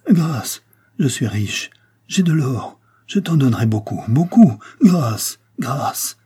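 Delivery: high, urgent pleading in short bursts; broken-voiced begging. A narrator's reading. Captive begging his captor for mercy, broken and panicked. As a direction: beg desperately, breaking off between phrases, fading on the last word. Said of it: "d'une voix entrecoupée".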